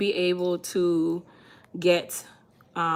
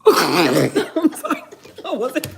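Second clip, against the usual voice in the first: slow, laughing fake apology; 5 semitones higher, 9 dB louder, slower than usual. Real, not acted. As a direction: says sorry through laughter.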